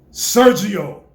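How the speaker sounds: very loud Sergio; said with power. Shown as a demonstration. Coach showing the boy a strong Sergio, very loud. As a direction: push it out on the breath. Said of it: "Get your breath. Breathe".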